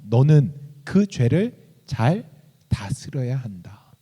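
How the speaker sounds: scripture reading. Acted. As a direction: proclaim the verses with weight. Reads scripture aloud. (힘 있게 낭독하는 성경 구절)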